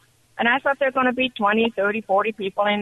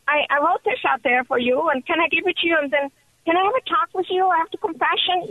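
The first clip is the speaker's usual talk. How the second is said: nervous excitement; 7 semitones higher than usual. Retold or imitated. Nervous caller asks archbishop.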